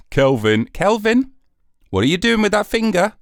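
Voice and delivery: sharp mock rebuke; scolding a child. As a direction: scold Kelvin like a naughty boy. Scolding Kelvin as a naughty child.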